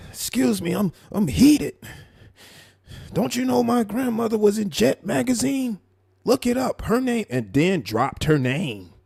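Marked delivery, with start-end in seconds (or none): 0.1-7.3 high-pitched mock indignation; heated, pumped up. Mocking a man bragging about his grandmother in Jet magazine, heated. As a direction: imitate someone worked up, pitched way up. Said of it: "you so heated and pumped up and".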